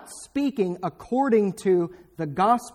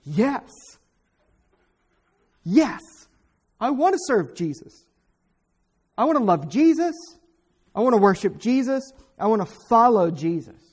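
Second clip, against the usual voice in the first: eager pledge; 3 semitones higher, 4 dB louder than usual. Acted. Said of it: "and you say".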